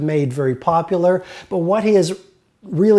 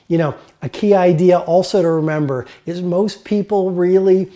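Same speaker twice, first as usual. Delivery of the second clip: usual speaking voice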